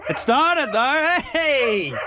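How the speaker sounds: high, drawn-out happy cry; real delight. Real, not acted. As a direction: celebrate the car starting, stretched out high. Car has just started.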